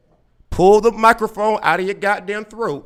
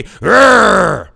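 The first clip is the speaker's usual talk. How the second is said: mock angry roar; 2 semitones higher, 10 dB louder than usual. Host mimics triggered caller, mock angry roar. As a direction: blurt an exaggerated roar of frustration. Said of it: "that made you say".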